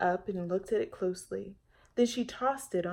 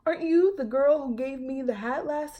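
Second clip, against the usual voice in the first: high, loud friendly greeting; park worker recognizing the girl; bright clerk voice; cheerful young man; 7 semitones higher, 9 dB louder than usual. Acted. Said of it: "young man at counter".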